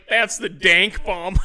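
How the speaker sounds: loud, high, drawn-out punchline; on the brink of laughter; gleeful reveal. Retold or imitated. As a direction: imitate his proud reveal, slowly. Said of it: "he starts laughing so hard".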